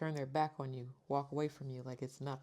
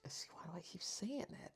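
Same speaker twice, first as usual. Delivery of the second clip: unvoiced whisper, softer; whispered aside; 3 semitones higher, 7 dB quieter than usual. Real, not acted. Reader catching her own card-name slip, whispering. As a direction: breathe it to yourself, embarrassed at the slip.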